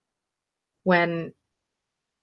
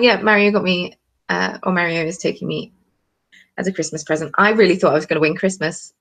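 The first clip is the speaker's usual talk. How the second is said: ordinary talk, nothing marked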